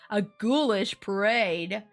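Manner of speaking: usual speaking voice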